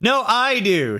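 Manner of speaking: high, mild mock shout. A narrator's reading.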